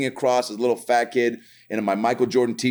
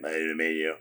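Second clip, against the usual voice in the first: lower, softer electrolarynx imitation; voice box voice; 7 semitones lower, 8 dB quieter than usual. Man with voice box greeting boy, low buzzy imitation. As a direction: flatten it like a voice box, low and soft. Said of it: "a guy with a voice box".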